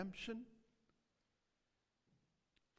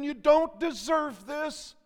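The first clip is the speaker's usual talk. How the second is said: loud, high, slow and emphatic; impassioned Savior's declaration; 10 semitones higher, 9 dB louder than usual. Acted. Preacher voices the Savior speaking about your salvation, impassioned. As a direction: press each word with raised voice. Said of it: "what your Savior would have to say to you".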